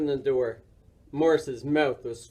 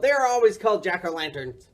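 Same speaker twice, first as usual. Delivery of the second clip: ordinary delivery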